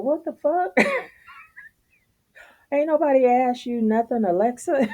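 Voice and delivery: high, mocking outrage at the speaker device; squealing at Alexa to mind her business; exasperated. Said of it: "You're like".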